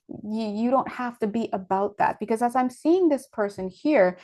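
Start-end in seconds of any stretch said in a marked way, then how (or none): none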